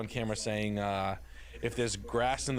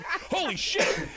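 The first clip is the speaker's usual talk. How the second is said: mock amazement; 4 dB louder, much slower than usual. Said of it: "It was like".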